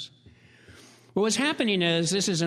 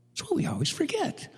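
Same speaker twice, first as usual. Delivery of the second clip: higher, lightly voiced little girl; girl's voice; 12 semitones higher than usual. Little girl tells the visitor what everyone forgets, lightly voiced. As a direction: say it like a child explaining the obvious. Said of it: "looked at him like he was kinda dumb".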